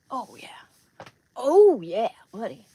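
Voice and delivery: big, loud groan of relief after the stretch; loud, satisfied outburst as his back pops; loud relieved groan. Real, not acted. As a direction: let the back crack and moan out loud in relief.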